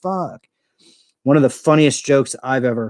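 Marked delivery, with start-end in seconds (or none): 0.0-0.5 high, squealing disbelief; mock astonishment. Imitating the stranger's amazed reactions, high mock astonishment. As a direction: crank up the amazement each time. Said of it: "just the way the guy was going".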